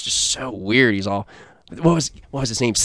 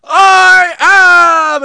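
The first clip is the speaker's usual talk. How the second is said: very high full shout; hammed-up metal singer scream; frontman scream; 9 dB louder than usual. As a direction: scream it to the back of the hall. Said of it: "he goes".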